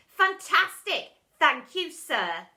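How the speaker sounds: high, loud delight, slow; delighted boy. A narrator's reading. Boy thanking the teacher, thrilled. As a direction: gush with joy.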